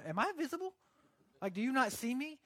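high-pitched, incredulous questioning; mock bewilderment. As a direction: act baffled, as if nobody can see you. Acts bewilderment at being overnamed.

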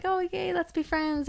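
high-pitched, gushing mini-impression; mock eager excitement. Traveler to new hostel friends, high mock excitement.

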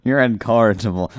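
ordinary talk, nothing marked

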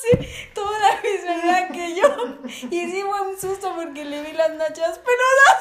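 high, loud, laughing through the words; can barely get it out for laughing; laughing hard. Real, not acted. Recalling the hairy-backside scare, laughing loudly.

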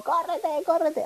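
panicked, drawn-out plea to get away; scared yelling. Retold or imitated. Girl in the car recoiling from her sister's touch, panicked. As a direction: squeal at your sister to back off. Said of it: "ella me quería tocar y yo así como".

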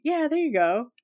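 usual speaking voice

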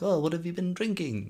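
chirpy mock old-man question; little old man. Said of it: "the little man's like".

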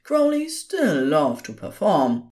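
ordinary delivery

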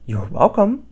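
high, bright bird voice; chirpy bird. A narrator's reading. Little bird chirping to the beast.

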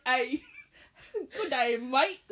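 mock Aussie "Good day, mate", laughing; hammed-up Australian greeting, laughing; Australian accent. Shown as a demonstration. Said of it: "was that Australian?".

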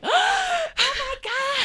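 high, slow, stunned "oh my God"; shocked gasp. Retold or imitated. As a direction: gasp it out like you're amazed. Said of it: "and be like".

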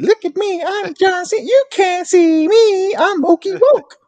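high, slow, show-off taunt; sing-song wrestler bragging impression; mocking John Cena boast. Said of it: "You used to spin that all in high school, right?".

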